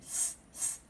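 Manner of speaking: S hissed through clenched teeth; S pushed through locked teeth; slurred S. Real, not acted. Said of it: "I can't do my S's properly".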